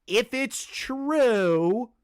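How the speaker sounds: "true" stretched long, high and loud; "If it's true" very slow, building to "true"; emphatic stress. Real, not acted. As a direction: draw out "true" into a loud rising hang. Emphatic condition before calling Gray clown.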